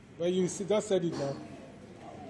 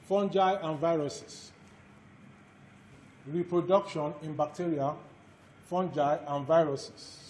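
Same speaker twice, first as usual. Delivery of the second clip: dictation. Acted. Lecturer dictates course outline.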